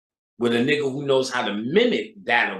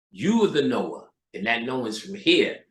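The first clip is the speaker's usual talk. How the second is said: usual speaking voice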